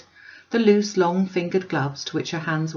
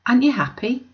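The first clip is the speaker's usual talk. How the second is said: high, louder wondering question; surprised old woman; 7 semitones higher, 5 dB louder than usual. A narrator's reading. Old woman asking Stephen, high and wondering. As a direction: ask as if the answer is surely yes.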